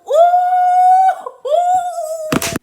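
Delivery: long high loud "ooh"; fired-up wordless whoop; worked-up whoop. Retold or imitated. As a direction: let out a high, held hoot of rage. Worked up over retold fight.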